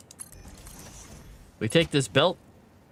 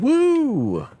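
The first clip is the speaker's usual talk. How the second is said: loud, high whoop of amazement; excited whoop; 13 semitones higher, 6 dB louder than usual. Real, not acted. Streamer whoops at the machine's huge power draw, loud and high. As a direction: let out a big gleeful woo.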